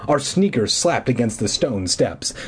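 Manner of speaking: plain reading voice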